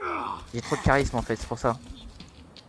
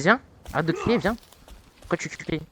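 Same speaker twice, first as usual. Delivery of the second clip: usual speaking voice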